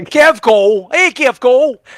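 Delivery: put-on a loud, high Chicago-accent greeting. Host greeting a chat viewer, in a loud Chicago accent. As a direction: call out the name in a broad Chicago accent. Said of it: "fun to say in the Chicago accent".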